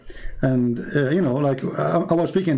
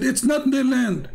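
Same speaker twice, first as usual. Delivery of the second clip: higher, drawn-out mock insistence; insistent objection; 8 semitones higher, slower than usual. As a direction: imitate a stubborn questioner, slow and high. Questioner insisting in retold argument.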